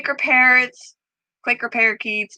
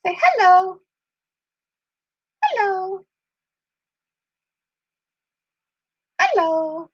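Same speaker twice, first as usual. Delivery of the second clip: much higher sing-song coaxing; high pet-talk coaxing; 11 semitones higher than usual. Real, not acted. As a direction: coax the bird gently up high.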